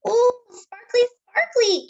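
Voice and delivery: put-on high, loud, gleeful child voice; delighted child. Casey admiring his sparkly bracelet, high and gleeful. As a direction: squeal it out with delight.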